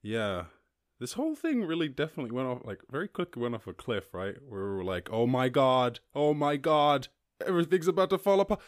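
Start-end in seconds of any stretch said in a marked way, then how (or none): 5.1-8.6 mock panic. Mock panic at pandemic start.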